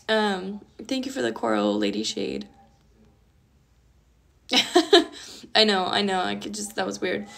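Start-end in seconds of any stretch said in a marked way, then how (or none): 4.5-5.5 loud rising laugh; self-conscious real laugh. Real, not acted. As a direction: let a laugh burst out. Laughing after an awkward moment.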